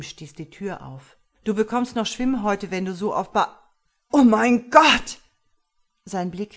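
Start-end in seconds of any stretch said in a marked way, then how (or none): 1.4-3.6 teasing call. Acted. Husband teasing before discovering body. 4.1-5.2 loud, drawn-out shocked cry; horrified cry. Acted. Husband finding his dead wife, loud horrified cry.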